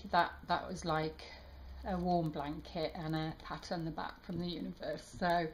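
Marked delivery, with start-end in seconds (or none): none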